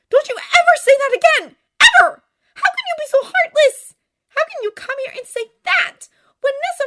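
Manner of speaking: put-on very high, loud furious young woman; outraged cry. A narrator's reading. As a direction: shout it in shrill-pitched indignation. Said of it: "she cried".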